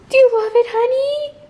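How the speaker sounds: high cooing voice for a pet. Real, not acted. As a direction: coo at the little one. Talking to her pet.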